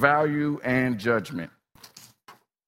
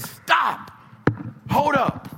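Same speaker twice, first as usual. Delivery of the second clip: commanding call; 12 semitones higher, much slower than usual. Acted. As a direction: halt the crowd. Jesus halts the crowd.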